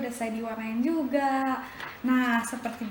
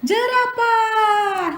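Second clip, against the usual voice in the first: very slow, higher and louder; drawn-out answer; 10 semitones higher, 9 dB louder, much slower than usual. Acted. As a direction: stretch the word out for the kids. Answer stretched out for kids.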